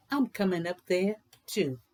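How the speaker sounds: lilting, sing-song read-aloud voice; bouncy rhythmic picture-book reading; storybook narration. A narrator's reading. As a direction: read it like a rhyme to children. Reads a rhyming picture book.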